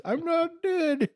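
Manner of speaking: very high, slow falsetto squeak; squeaky old man. Retold or imitated. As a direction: squeak it out slowly, feeble but insistent. Quoting the sketch again.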